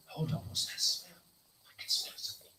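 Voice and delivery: hushed, breathless whisper to himself; whispered thought. Retold or imitated. Waking in the night, he whispers nervously to himself. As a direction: whisper it like you're sniffing the dark.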